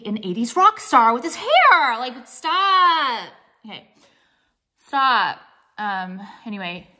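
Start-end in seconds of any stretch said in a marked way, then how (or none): 0.0-5.4 mock horror. Real, not acted. Mock disgust at own hair.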